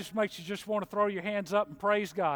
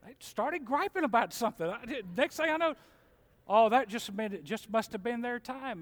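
ordinary talk

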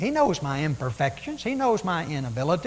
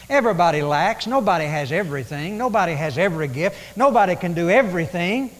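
ordinary talk

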